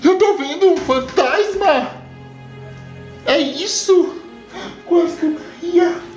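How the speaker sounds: high, startled woman's cry; frightened. Acted. Maria Navalha seeing a ghost, high and frightened. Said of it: "toma um susto".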